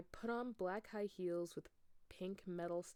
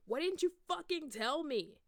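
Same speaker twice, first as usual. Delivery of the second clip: higher, louder betrayed yell; hurt shout; 9 semitones higher, 6 dB louder than usual. Acted. Draco shouting at Ebony, hurt and loud. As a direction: shout it out, wounded. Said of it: "he shouted".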